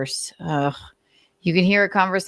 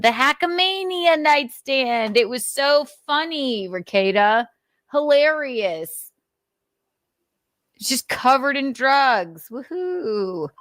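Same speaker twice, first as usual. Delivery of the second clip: sarcastic mockery; 7 semitones higher than usual. Real, not acted. Mocking the streamer over the drug-covered nightstand, sarcastic. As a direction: sneer it, pitched way up.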